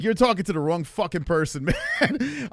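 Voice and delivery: retold telling-off breaking into laughter; laughing rebuke. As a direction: crack up as you protest. Retells rebuke while cracking up.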